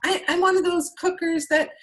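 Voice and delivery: high mock voice of a casual cook; a lightly mocking high imitation of a guest; imitating a customer. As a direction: pitch way up to mimic a breezy home cook. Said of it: "some people go".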